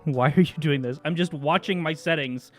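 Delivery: usual speaking voice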